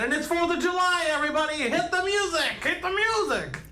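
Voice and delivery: high, loud party hype; rally everybody, high and loud; hype announcer. Real, not acted.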